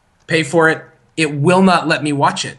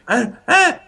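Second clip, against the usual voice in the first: two short high cries; mock little yelps before conking out; imitated toddler passing out; 8 semitones higher than usual. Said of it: "she literally was like".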